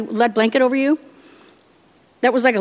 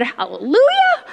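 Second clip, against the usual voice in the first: drawn-out, much higher, slow hallelujah; joyful hallelujah; 10 semitones higher, slower than usual. Retold or imitated. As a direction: stretch it up high in praise. Answering the preacher's blessing.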